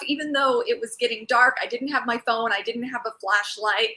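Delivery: ordinary delivery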